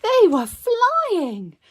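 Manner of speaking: high, loud, stretched-out climax; triumphant storybook reveal; thrilled narration. A narrator's reading. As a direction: soar up and draw the words out. Climax of the ride.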